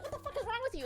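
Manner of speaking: high-pitched disbelief, mock shocked; shocked outrage. Percy reacting to the pen-throwing teacher, high shocked outburst. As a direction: yelp it in startled disbelief. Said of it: "he's like".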